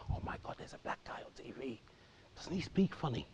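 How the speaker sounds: hushed, breathless whisper of amazement; amazed whispering family watching Trevor McDonald; awed whisper. Retold or imitated. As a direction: whisper it like kids huddled at the telly. Family gathered around the TV.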